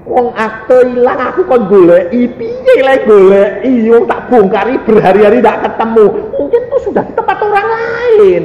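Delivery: put-on high, exasperated complaint of an old woman; ibu tua mengeluh. (keluhan jengkel bernada tinggi)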